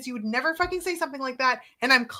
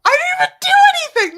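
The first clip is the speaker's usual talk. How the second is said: high, loud wail of innocence; shouted mock-outraged self-defence; indignant protest; 10 dB louder than usual. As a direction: cry out in hammed-up disbelief. Retold protest after being scolded.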